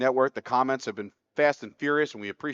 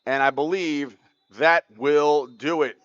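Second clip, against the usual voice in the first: play-by-play call; 3 semitones higher, 6 dB louder, slower than usual. Acted. Calls the play's result.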